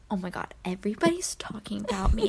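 soft unvoiced whisper, mock paranoia; hushed, drunken paranoia; paranoid whisper. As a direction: whisper it like everyone is against you. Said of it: "Och du bara".

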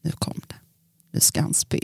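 whispered alarm, barely voiced. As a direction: hiss it as a nervous aside. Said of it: "då var jag så här". (viskat, oroligt)

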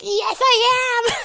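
squeaky high, slow triumphant boast; mock boast. Host answering fans as a hammed-up diva. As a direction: crow it like a diva. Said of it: "And I'm like".

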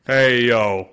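big, punchy "Hey, yo"; loud podcast greeting. Real, not acted. Host opening the podcast, loud punchy greeting. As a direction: call it out to open the show.

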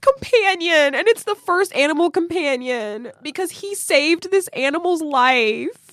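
teary, choked-up voice; after crying. Real, not acted. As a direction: talk through tears, high and wavering. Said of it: "I need to blow my nose".

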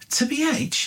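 high, baffled repeat of the slang; incredulous echo. Retold or imitated. Mocking a stranger on a London bus, high and incredulous. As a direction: throw it back in disbelief.

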